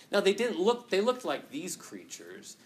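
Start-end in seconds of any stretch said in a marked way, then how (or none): none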